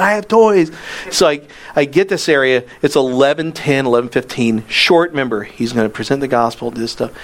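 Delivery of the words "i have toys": raised, higher call into a bad line; repeating louder on the phone. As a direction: say it again louder so he hears. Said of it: "I said".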